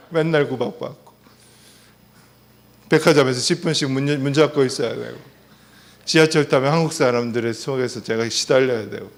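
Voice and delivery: ordinary talk, nothing marked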